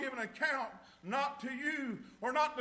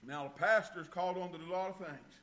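normal talking voice

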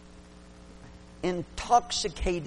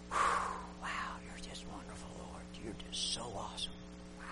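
hushed whispered praise, much softer; awed whisper; 6 semitones lower, 14 dB quieter, faster than usual. Retold or imitated. Worshipper in the pew sensing God's sweetness, awed whisper. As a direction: breathe it out in reverent awe.

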